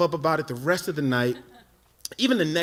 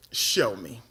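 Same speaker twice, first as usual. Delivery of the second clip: cool friend; 7 semitones lower than usual. Retold or imitated. Friend's cool reply acted.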